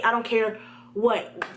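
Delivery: normal talking voice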